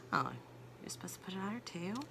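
hushed quick aside; whispering. Real, not acted. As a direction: whisper it to yourself.

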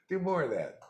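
usual speaking voice

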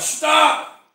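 loud, high urgent shout; acted alarmed scream; scream of warning. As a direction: yell to halt a child in danger. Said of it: "you scream at the child".

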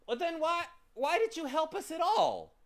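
high, loud, indignant demand; furious high-pitched challenge; angry shout. A narrator's reading. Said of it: "shouted Milo angry".

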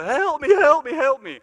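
high, loud cry for help; screaming for help. Retold or imitated. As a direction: yell it out, desperate. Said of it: "I started screaming at the top of my".

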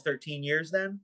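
normal talking voice

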